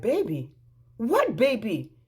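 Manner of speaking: acted-out incredulous echo; sharp astonished question; shocked questioning. Learns of his baby.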